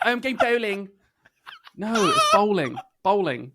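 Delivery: Bowling said with a long rounded O, stressed; showing pronunciation. Shown as a demonstration. Correcting the host's Bowling. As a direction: stress bowling to show the right vowel. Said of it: "No, not bowling".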